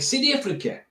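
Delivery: high-pitched attempted South African accent. Shown as a demonstration. Vlogger showing off his South African accent, amateur and pitched high. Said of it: "So my South African accent was pretty close".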